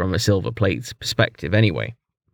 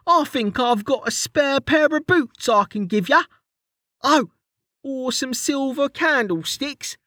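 much higher, cheeky rough-edged mate ribbing his friend; Kettle's high teasing voice; 13 semitones higher than usual. A narrator's reading. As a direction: tease him in a high jokey voice.

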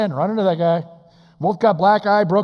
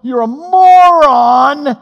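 loud, very slow, high put-down; God rebuking the foolish man; mock divine rebuke; 9 semitones higher, 12 dB louder, much slower than usual. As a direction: drag out the insult. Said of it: "God slaps him up ... going".

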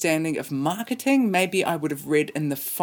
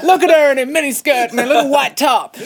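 high, loud mock shout; hammed-up impressed yelling; rowdy metal fan; 9 semitones higher, 7 dB louder than usual. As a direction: holler it like a fan in the crowd. Imitates metal crowd shouting.

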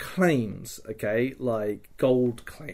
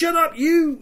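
much higher, loud mock snap; captor snapping; 6 dB louder than usual. Captor shouts at the mouthy prisoner to shut up. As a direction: shout him down, shut up you.

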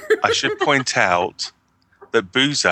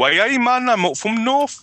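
put-on Newcastle accent; 12 semitones higher than usual. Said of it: "They say".

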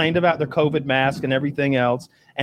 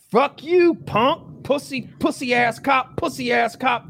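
hammed-up trash-talking woman; mock tough talk; 10 semitones higher, slower than usual.

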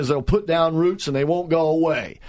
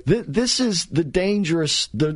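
normal talking voice

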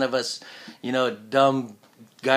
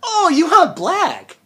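much higher, slow, loud realization; surprised realization; 9 dB louder, much slower than usual. Retold or imitated. Voice actor realizing what the client means, surprised. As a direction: let it dawn on you, drawn out and high.

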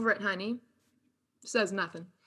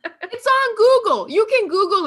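laughing defense; 11 semitones higher, 9 dB louder than usual. Real, not acted. Defends J Lo home plan.

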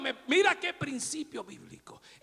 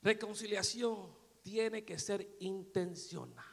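quiet, slow, lower statement; soft and slow; 6 semitones lower, 11 dB quieter, slower than usual. Shown as a demonstration. Preacher first says it softly to the church, slow. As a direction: say it gently and slowly. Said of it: "Lo digo de nuevo".

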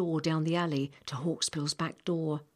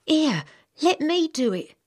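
put-on much higher, louder girl's voice, stress on let; a eager eleven-year-old girl stepping in; young girl; 11 semitones higher, 8 dB louder than usual. Samantha offering the old man help, high girl's voice. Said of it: "When Samantha said".

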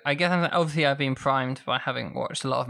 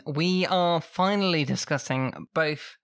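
ordinary talk

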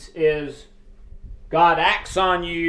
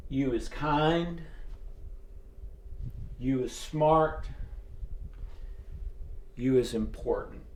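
very sweetly; 4 semitones lower, 10 dB quieter than usual. Retold or imitated. Maid soothing the little girl, softly and sweetly. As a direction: coo it gently into her ear. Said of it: "very, very sweetly".

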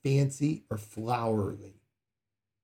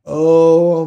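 mock pious opener; 6 semitones higher, 15 dB louder than usual. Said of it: "you don't go".

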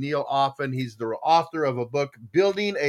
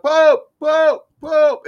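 high, loud repeated call; acted yell; 12 semitones higher, 10 dB louder, much slower than usual. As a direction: yell up to a distant balcony. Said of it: "he's yelling up".

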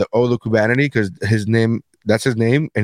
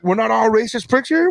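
defensive protest; 9 semitones higher than usual. Retold or imitated. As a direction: hands up, backing off. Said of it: "he literally put his hands up like".

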